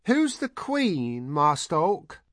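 very high, drawn-out eager question; high-pitched eager calling; crying out. A narrator's reading. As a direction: cry it out high and insistent. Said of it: "Heather cried".

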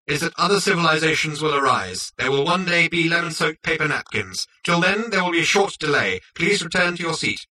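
high, precise machine voice; prim autopilot. A narrator's reading. Autopilot lectures Zaphod on the odds, prim machine voice. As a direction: recite it primly, pitched well up. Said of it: "continued the autopilot primly".

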